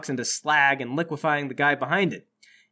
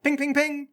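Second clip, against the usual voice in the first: high-pitched pinging noises; imitated ricochets; 9 semitones higher than usual. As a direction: mimic bullets bouncing off metal.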